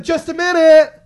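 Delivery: high, loud singled-out woman's shout; mock calling out. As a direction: imitate her calling through the stall door. Imitates woman calling from stall.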